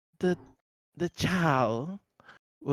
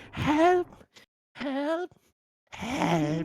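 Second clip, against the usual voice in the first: put-on lost child crying help; 11 semitones higher, much slower than usual. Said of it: "the boy would say".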